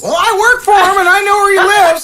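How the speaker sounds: high, loud character voice; eager Tom. Acted. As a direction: pipe up high and quick as Tom.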